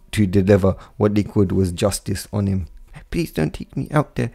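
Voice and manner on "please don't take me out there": higher, softer, pleading in fear; a frightened boy begging softly; soft fearful plea. A narrator's reading. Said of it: "Naruto softly says with fear".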